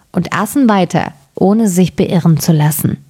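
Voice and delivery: usual speaking voice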